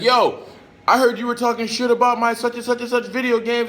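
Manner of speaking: put-on high, loud taunting kid voice; mock a neighborhood kid's challenge; kid picking a fight. Retelling the kid who jumped him, high taunting voice. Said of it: "He's like".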